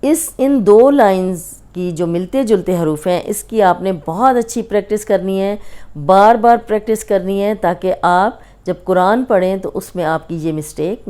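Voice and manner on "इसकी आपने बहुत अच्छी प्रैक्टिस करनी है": ordinary delivery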